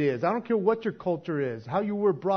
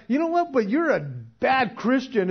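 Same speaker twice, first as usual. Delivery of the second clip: mock judgmental; 7 semitones higher, 6 dB louder than usual. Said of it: "it's like".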